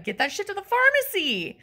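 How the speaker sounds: high-pitched, insistent demand; exasperated outburst. Acted. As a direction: lose patience with the warehouse guy. Repeated demand, escalated.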